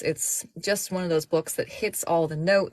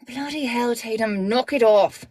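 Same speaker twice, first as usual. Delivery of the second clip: put-on exasperated older woman; 6 semitones higher, 5 dB louder than usual. Older woman scolding Tatum.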